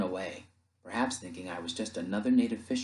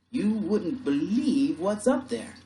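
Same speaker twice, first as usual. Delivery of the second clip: high, loud, slow disbelief; drawn-out, raised amazed voice; amazed sailor; 10 semitones higher, 6 dB louder, slower than usual. Acted. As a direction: report it in astonishment. Sailor amazed by what he found.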